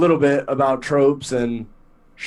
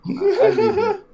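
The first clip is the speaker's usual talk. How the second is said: laughing. Real, not acted. Laughs through a joke.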